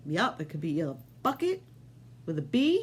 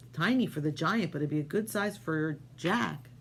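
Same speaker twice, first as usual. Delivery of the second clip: ordinary delivery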